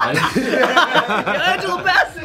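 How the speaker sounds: high, drawn-out mock squeal of a name; mock swooning scream. Friend teasing another for screaming her name, mock scream. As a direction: imitate a turned-on fan crying out, laughing. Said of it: "You just screamed her name".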